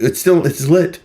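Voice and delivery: high mocking imitation of the caller; sarcastic high mimicry of the caller; mimicking her. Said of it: "She's like".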